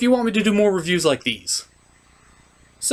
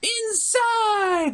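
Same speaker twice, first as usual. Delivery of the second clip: shrill-pitched cartoon squeal, much higher; squeaky high yell. Puppet villain threatening to go inside, squealing high.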